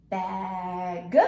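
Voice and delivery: BAG held long, G released separately; slow level Bag with a detached G; stretched-out word. Shown as a demonstration. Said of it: "let's review these".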